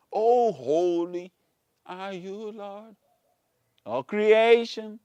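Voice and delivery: slow, drawn-out praise; stretched, high mock adoration; mock worship. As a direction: imitate a rapturous worshipper. Mimics worshippers praising this God.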